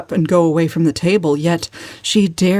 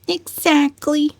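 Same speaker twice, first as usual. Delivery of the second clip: much higher, slow, earnest agreement; unsuspicious; 10 semitones higher, slower than usual. A narrator's reading. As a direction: agree without catching the irony. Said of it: "said cousin Georgiana unsuspiciously".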